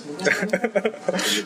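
amused laugh at own story; real chuckle mid-story. Real, not acted. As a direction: laugh after telling the line. Laughs at own retold line.

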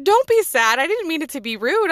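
put-on high, loud hurried pleading to the boy; anxious pleading. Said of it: "I was like".

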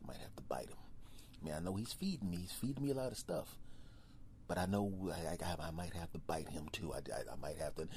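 low, fast whisper; secret thoughts. Acted. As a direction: whisper a sneaky plan. Bear's hushed plotting.

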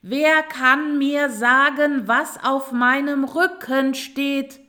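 slow, high, very loud shout; full-voiced, drawn-out demand; loud calling out. A narrator's reading. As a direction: shout each word over the noise. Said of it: "rief sie noch einmal".

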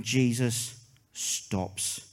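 Jesus, then a long pause, stopped whispered; preacher's hushed refrain, pause before stopped; hushed dramatic refrain. Acted. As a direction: let the pause hang before a breathy stopped. Refrain again.

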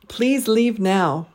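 Please, leave, now each stressed slowly, now stretched up then down; slow three-beat Please leave now; deliberate stress on every word. Shown as a demonstration. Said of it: "stress three syllables in each phrase".

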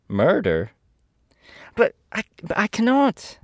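put-on little girl's startled exclamation, leaping high; greatly surprised. A narrator's reading. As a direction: stammer in shock, pitch shooting up. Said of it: "exclaimed Dorothy, greatly surprised".

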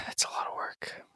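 sighing, whispered and weary. Real, not acted. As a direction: breathe it out tiredly. Tired sigh at extra work.

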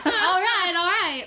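high, exasperated "all right", falling; weary husband giving in. Retold or imitated. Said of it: "Hanukkah Harry".

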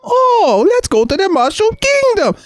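exaggerated Italian plumber mimicry; Mario impression. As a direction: squeak it out like the game plumber. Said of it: "somebody talking like this".

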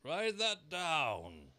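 slow, high, loud king; drawn-out royal order. A narrator's reading. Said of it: "the king said".

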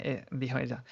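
ordinary delivery

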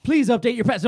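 loud, high, robotic announcement; mock Alexa voice. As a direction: blare it like a smart speaker.

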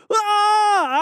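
long high mock scream, held; a hammed-up terrified wail. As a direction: scream like bats hit you. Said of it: "I scream".

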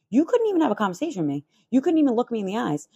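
firing back at the neighbour quickly; heated retort. Retold or imitated.

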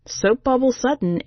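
usual speaking voice

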